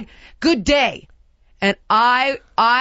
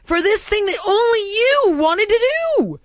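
higher, rising imagined telling-off; pretend tirade; 8 semitones higher than usual. Imagined rant at Christy over the money, rising higher. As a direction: build an imagined rant at her. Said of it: "I wanna be like".

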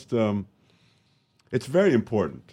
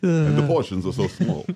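put-on Portland accent; 7 semitones higher than usual. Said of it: "that's a great Portland accent".

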